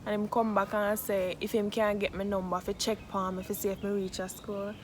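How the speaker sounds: ordinary talk, nothing marked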